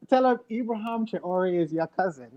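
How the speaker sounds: put-on African accent. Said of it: "in an African accent".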